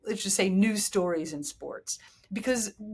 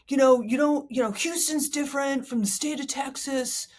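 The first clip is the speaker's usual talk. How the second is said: higher put-on voice, evenly pitched; Houston fan voice; 5 semitones higher than usual. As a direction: put on a higher voice for the defensive locals. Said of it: "why I'm talking like this".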